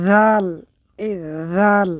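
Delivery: "জাল" said thin and light, a clear demo. Shown as a demonstration. Teacher demonstrates the light "জাল" to students. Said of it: "এটি হচ্ছে পাতলা করে উচ্চারণ করতে হবে".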